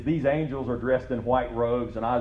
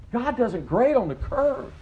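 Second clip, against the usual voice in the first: preached climax; 12 semitones higher than usual. Acted. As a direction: drive the line home from the pulpit.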